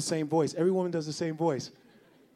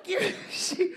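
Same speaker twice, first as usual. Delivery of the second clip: high pleading voice. Acted. Voicing a woman.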